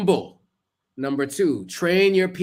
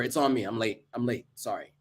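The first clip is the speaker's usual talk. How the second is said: quiet sincere apology; 4 semitones lower, 6 dB quieter than usual. Shown as a demonstration. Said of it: "Not blah, blah".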